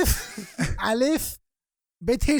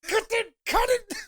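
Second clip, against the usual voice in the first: mock director call; 13 semitones higher, slower than usual. Mock director calling cut.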